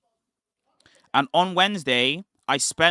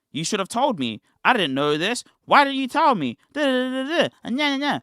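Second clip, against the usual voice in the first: higher-pitched imitation of a friend's nagging; mock indignant complaint; 7 semitones higher than usual. Friend scolding him for not saying he arrived, mock indignant. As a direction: climb into mock protest, ending in babble.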